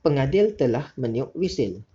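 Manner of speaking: "Pengadil telah meniup wisil" read calmly, falling at the end; relaxed falling statement intonation; steady even statement, no rise; calm statement tune. Shown as a demonstration. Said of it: "tu cara nada yang betul".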